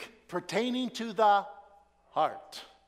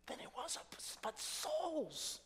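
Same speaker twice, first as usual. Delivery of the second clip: whisper; 9 semitones higher, 10 dB quieter than usual. Acted. Preacher's pointed whisper.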